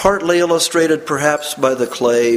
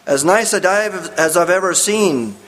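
an eager, admiring call, a touch higher; admiring cry; 6 semitones higher than usual. A narrator's reading. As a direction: praise the dive with open delight. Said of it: "cried David Morse".

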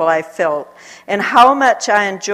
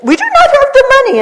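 much higher, louder and fast protest; 10 dB louder, faster than usual. Retold or imitated. Shopkeeper yelling back at the missionary, high and loud. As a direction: shout back in alarm.

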